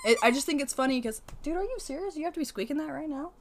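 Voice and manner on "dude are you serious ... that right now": exasperated, high and fast; fed-up scolding; scolding the dog. Real, not acted. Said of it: "That is [name] talking to her dog".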